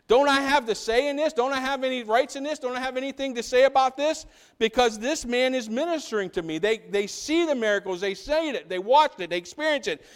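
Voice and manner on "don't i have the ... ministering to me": high, pleading string of questions; indignant protest. Acted. Common people protesting to their leaders, indignant. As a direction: plead for a say, voice pitched up.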